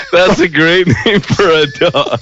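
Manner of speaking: slow, laughing through the words. Real, not acted. Amused co-host reacting to a dog named Puss, laughing. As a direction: say it while cracking up. Cracking up over the name.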